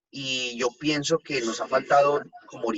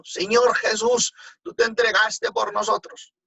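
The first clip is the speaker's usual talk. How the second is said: imitated full-voice acclamation, much higher; a voz en cuello; 11 semitones higher, 4 dB louder than usual. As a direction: draw out the name, crying it high and loud. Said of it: "nuevamente a voz en cuello". (a voz en cuello, imitando un clamor agudo y fuerte)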